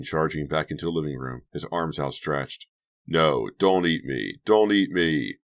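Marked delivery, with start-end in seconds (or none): 3.1-5.4 slow, drawn-out pretend pleading; mock fright. Chuck flees the pretend monster, hammed-up fear. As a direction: beg for mercy, hamming it up.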